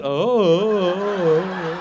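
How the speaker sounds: long vacant "oh"; spaced-out boy. Retold or imitated. Comic's son again misses it, dopey drawn-out oh. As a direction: hold the oh, oblivious. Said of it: "boys get a little spaced out".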